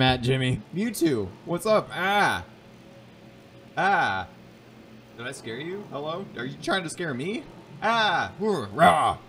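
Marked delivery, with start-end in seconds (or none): 0.7-4.3 startling boo. Pretend. Startling plush toys jokingly. 7.8-9.2 loud playful scare shouts; startling boo. Pretend. Jumping out at a toy.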